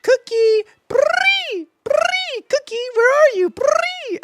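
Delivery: tiny creature chirps calling the dog; Togepi sounds to dog. Shown as a demonstration. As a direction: squeak at the dog like a baby Pokemon. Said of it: "the way that I talk to my dog is like a crazy person".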